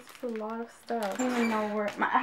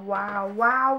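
ordinary delivery

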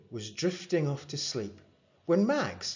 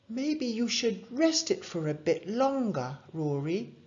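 higher, lightly voiced gentle mum; 11 semitones higher than usual. A narrator's reading. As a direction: coax him kindly to rest. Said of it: "said his mum".

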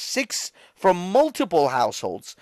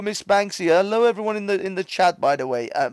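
normal talking voice

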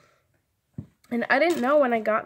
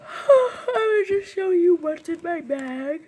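high, loud sighing voice, slow; 7 semitones higher, 7 dB louder, slower than usual. Real, not acted. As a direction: sigh the words out. Sighing, voice changes oddly.

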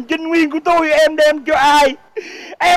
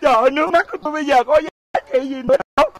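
rushed lament; much faster than usual. Acted. Comic lament.

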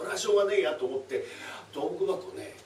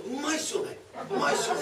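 mimic praise; 12 semitones higher than usual.

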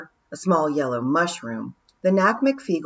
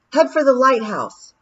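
louder, higher urgent order; commanding call; 8 semitones higher, 7 dB louder than usual. Acted. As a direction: call the order over the waves. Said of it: "she said".

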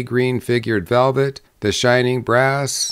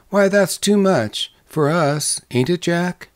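higher-pitched, lightly voiced young wife's protest; mild dismay, pitched up; protesting bride; 6 semitones higher than usual. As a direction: object in the bride's raised pitch. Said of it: "cried the bride".

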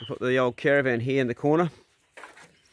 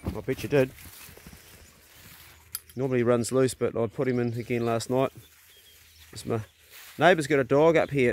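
ordinary delivery

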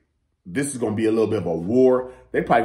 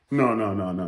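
ordinary delivery

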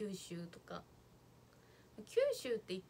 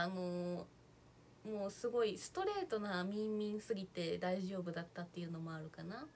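ordinary delivery

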